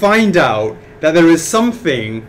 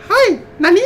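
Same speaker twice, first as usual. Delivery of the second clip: much higher, sweet and chirpy; high cute Japanese voice; 3 dB louder than usual. Retold or imitated. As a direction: squeak it out like a cute girl. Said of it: "It's like".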